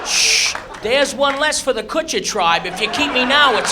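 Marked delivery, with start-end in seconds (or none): 0.0-0.5 unvoiced snuffing shh; hissed shush. Contestant snuffing his own torch, unvoiced.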